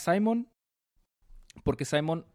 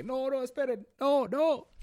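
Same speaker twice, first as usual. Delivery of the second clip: very high, panicked pleading; panicked plea; 13 semitones higher, slower than usual. Retold or imitated. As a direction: squeal for them to wait. Volfunga pleading when arrested. (súplica aguda y asustada)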